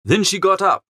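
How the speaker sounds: usual speaking voice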